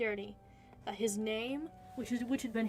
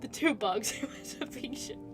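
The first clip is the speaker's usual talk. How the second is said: laughing; 3 semitones higher than usual. Real, not acted. Laughing at the bugs' romance.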